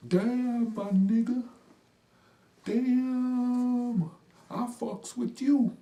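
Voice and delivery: high, loud and slow hyped voice; excited street slang. Retold or imitated. Imitating the kid saying he likes him, high and loud. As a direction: sell it big like the delighted kid. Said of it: "And he said".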